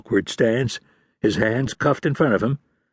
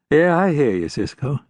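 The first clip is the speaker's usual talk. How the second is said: usual speaking voice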